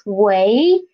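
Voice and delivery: 喂 drawn out, rising like a question; questioning second-tone 喂. Shown as a demonstration. Teacher showing an unsure caller's greeting, 喂 in questioning rising tone. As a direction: ask an unsure, slowly lifting 喂. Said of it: "the second tone of this word".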